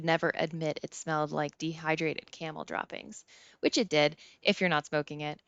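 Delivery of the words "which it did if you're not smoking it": ordinary delivery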